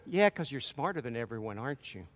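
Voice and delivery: low, soft sneering tempter voice; Satan taunting. Retold or imitated. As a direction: needle him quietly, mocking his pride. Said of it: "Satan comes up and goes".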